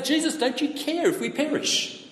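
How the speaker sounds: high, half-laughing frightened cry; panicked plea. Retold or imitated. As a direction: play the scared disciples, pitch up. Panicked disciples wake Jesus.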